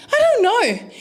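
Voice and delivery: high, loud mock helplessness; flustered son. Flustered son, voiced by mother.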